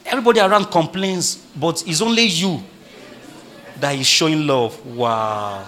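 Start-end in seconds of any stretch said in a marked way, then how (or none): 4.9-5.6 long, low drawn-out wow; put-on awe, pitched low; mock amazement. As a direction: draw it out in mock wonder. Preacher mocks the smitten reaction.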